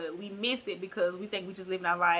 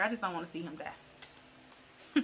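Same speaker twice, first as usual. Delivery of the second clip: mock weepy; 3 semitones lower, 8 dB quieter, slower than usual. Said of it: "And she was like".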